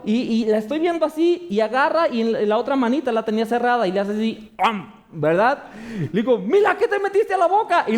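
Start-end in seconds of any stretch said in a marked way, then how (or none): 6.4-7.9 put-on much higher, alarmed call to the baby. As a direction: ask in sudden alarm what she swallowed.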